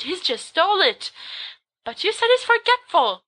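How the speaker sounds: high-pitched protest; indignant interruption. Acted. Nitpicking listener interrupts the storyteller, high indignant protest.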